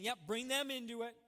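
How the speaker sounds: hammed-up encouragement of bad behavior; mock egging-on. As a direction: egg them on from the sidelines.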